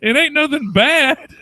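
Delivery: put-on high, loud, drawn-out old woman; cantankerous granny. Granny defending herself to her granddaughter, cantankerous old-woman voice. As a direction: stretch it out as a stubborn granny. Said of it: "cantankerous as fuck".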